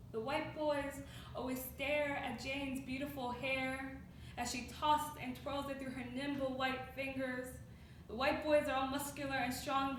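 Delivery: subdued spoken word delivery; hushed poetic recital. Acted. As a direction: recite it quietly, with deliberate weight. New stanza starts hushed.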